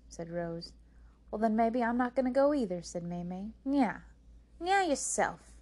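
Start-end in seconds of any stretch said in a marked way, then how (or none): 1.3-2.8 fast, loud, higher comeback; huffy retort. A narrator's reading. May May refuses to go outside either, huffily. Sisters quarrel over going outside. 3.6-5.5 high, loud sing-out jeer; childish taunting. A narrator's reading. Sisters bicker over going outside, taunting.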